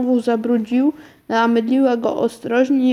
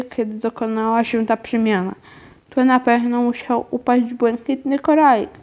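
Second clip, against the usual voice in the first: ordinary delivery, nothing marked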